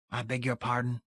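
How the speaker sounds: frosty soft rebuke; quiet frigid. A narrator's reading. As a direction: ice him with a hushed voice. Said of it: "in a quiet, frigid voice".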